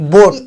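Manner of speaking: बोट as one syllable with a single "o" vowel; silent "a". Shown as a demonstration. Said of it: "ए यहाँ पे silent हो गया".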